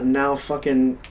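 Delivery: usual speaking voice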